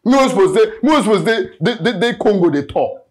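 loud, high, stumbling indignation; angry rant. Acted. Kidnapper complaining he should be on tour, outraged. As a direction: rant with words tripping over each other. Kidnapper rants about his career.

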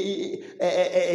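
ordinary talk

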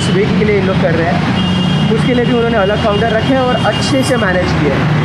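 muffled behind respirator; speaking outdoors masked; through a face mask. Real, not acted.

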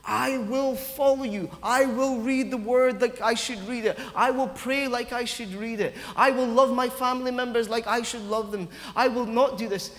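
an earnest vow of loyalty; emphatic vow. Retold or imitated. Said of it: "He's emphatic".

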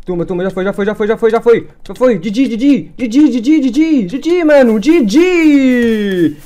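crowing over the win like a champion; triumphant celebration. Real, not acted. Gamer celebrating his win, loud and triumphant.

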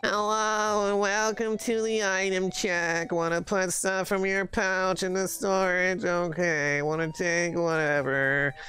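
low, slow, deadpan shop clerk; bored sarcastic clerk. Acted. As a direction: drag it out like you hate your job. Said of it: "He loves their job".

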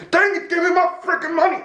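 high, strained acted-out yell; aggravated yelling. Man yelling at his money, aggravated. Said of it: "I'm yelling at the money".

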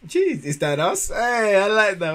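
excited. Real, not acted. Reacting to Kiwi slang.

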